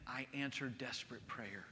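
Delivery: hushed, slow, very soft invitation; tender voice of Jesus. Acted. Jesus inviting the desperate to pray, hushed and slow. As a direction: speak gently as Jesus inviting. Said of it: "He's saying".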